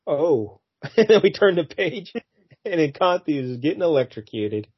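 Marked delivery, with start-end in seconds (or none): none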